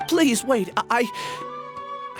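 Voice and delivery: urgent plea. Acted. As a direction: beg them to stay, then falter. Begs the departing woman to stay.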